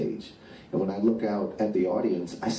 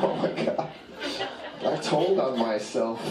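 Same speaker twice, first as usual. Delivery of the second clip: laughing while talking; 4 semitones higher than usual. Real, not acted. Cracks up telling weed story.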